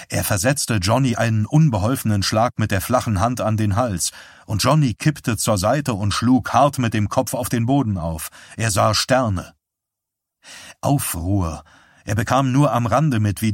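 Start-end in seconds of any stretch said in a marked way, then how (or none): none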